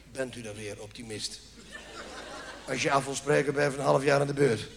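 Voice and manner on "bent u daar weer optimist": much lower and softer grumble; patient mutters back at the dentist, softly; muttered aside. Retold or imitated. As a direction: mutter it to yourself. Mumbled under breath reply.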